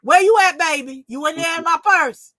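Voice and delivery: imitating grandma hollering for her; grandma calling out. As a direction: call out to a child in the next room. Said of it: "she had left and she said".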